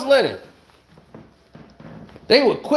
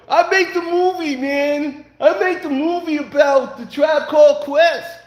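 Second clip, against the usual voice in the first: higher, lightly put-on boastful voice; mock filmmaker impression; 4 semitones higher than usual. Mocking the director bragging about his film, lightly put-on voice. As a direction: brag like the guy who made the movie. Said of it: "Him and Rappaport".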